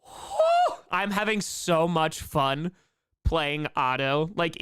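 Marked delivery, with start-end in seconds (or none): 0.0-0.8 a very high whoop; excited woo. Real, not acted. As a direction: whoop with delight. Proud of playing Otto.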